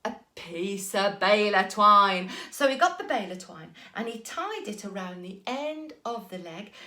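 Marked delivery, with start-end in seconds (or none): none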